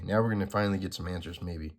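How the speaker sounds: usual speaking voice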